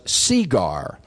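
"segar" with a long, stressed first syllable, SEE-gar; Virginia pronunciation. Shown as a demonstration. Telling the audience the proper Virginia way, "segar" as SEE-gar. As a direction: stretch "segar" out slowly, stressing the E. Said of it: "the proper Virginia pronunciation".

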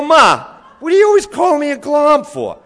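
put-on high, loud child's complaint; whiny kid. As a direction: whine at Ma like a wronged boy. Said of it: "I go".

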